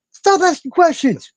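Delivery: high, slow, loud mock snap at the chat. Streamer snapping at chat to stop asking, loud and high. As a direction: cut off the question with a shrill-free high bark.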